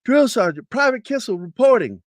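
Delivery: high, loud formal report; imitated military reporting voice; soldier reporting at attention. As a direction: sound off to a superior. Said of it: "standing at attention. You say".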